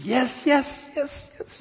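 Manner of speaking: squealed high yeses, the last breathless; overjoyed bride-to-be squeals yes; ecstatic yes. Acted. Woman accepting the ring squeals high yeses.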